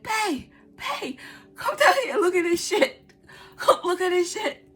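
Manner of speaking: high, loud frantic call with a cry in the voice; panicked calling. Retold or imitated. Calling her partner down to see the spider, frantic high cry. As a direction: yell for help, half crying.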